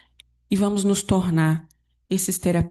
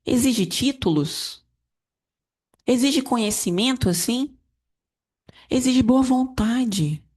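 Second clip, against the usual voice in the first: preaching; 6 semitones higher than usual. Acted. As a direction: build each "exige" like a sermon. Pregação em tom elevado. (pregação enfática e lenta)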